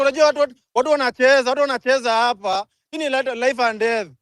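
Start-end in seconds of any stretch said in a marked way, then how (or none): 0.0-2.7 raised, heated protest; angry. Real, not acted. Speaker telling listeners people are playing with lives, angry. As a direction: push the pitch up in real indignation.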